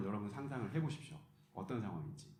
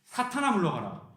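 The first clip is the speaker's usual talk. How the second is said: loud, much higher commanding rebuke; stern rebuke; 6 semitones higher, 7 dB louder than usual. Retold or imitated. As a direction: cast him away with full force. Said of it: "예수님이 베드로를 보고 뭐라고 했냐면". (베드로를 꾸짖는 예수님, 높고 크게 호령하듯)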